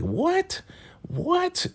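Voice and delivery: squealed high disbelief; shocked what. Retold or imitated. Laura hearing about FBI agents, shocked squeal. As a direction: shriek it way up high, stunned. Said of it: "She's like".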